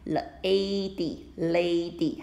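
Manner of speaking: "lady" with a long, drawn A; teacher modelling the long A sound; long a demo. Shown as a demonstration. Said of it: "长音".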